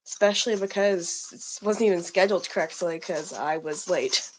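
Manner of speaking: ordinary delivery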